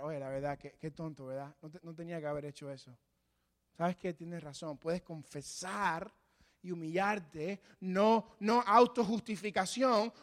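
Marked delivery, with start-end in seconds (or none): 0.0-4.8 softer, lower, sincere admission; humble confession. Pretend. A sinner admits fault to the pastor, humbly softer. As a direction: own the mistake quietly. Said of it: "Usted puede confesar".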